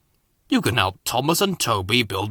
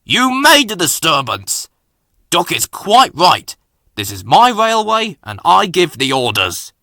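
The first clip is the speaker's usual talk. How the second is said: loud, raised authoritative voice; stern reprimand; 4 semitones higher, 6 dB louder than usual. A narrator's reading. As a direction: lay down the law loudly. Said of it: "thundered the Fat Controller".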